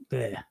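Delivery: soft, disgusted bleh sound; a small grossed-out noise under the breath. Retold or imitated. Picturing crack, a quiet disgusted bleh. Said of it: "when you think about those things".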